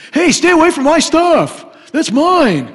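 high, loud, bratty whine; a grown-up acting like a toddler guarding toys; childish spouse. Voicing childish possessive spouse.

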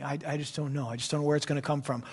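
soft, low, hesitant mumble; mock doubter. Preacher voicing someone unsure where provision comes from, quietly doubtful. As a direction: imitate a worrier, low and quick at the end.

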